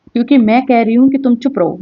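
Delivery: usual speaking voice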